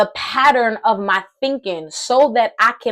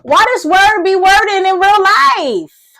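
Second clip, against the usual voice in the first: loud, high, slow exclamation; excited outburst; 8 semitones higher, 6 dB louder than usual. Real, not acted. Preacher thrilled at the word, exclaiming excitedly. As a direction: burst out, stretching the words in real excitement. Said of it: "I'm getting excited".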